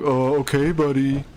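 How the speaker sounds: mocking imitation of feigned ignorance; mock-oblivious brush-off; playing clueless. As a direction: put on a know-nothing voice. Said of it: "he plays like he doesn't know anything".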